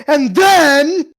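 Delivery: high, loud, stretched mimicry; mock his over-animated delivery, loud and high; imitating animated storyteller. Friend mimicking the storyteller, exaggeratedly animated. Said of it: "It was like".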